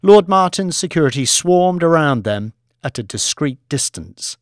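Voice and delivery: ordinary delivery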